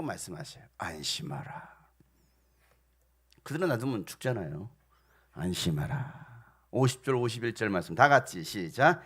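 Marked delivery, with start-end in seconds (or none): 0.8-1.7 low, soft reassurance; calm them down quietly. Acted. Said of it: "그들에게 말씀하시기를". 5.3-6.2 low, soft, soothing command. Acted. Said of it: "그들에게 말씀하시기를".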